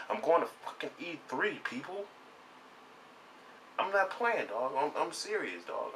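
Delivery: heated, high-pitched boast; fired-up rant. Real, not acted. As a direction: push it up high like you're done being doubted. Ranting about breaking into industry.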